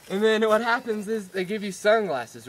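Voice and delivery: ordinary talk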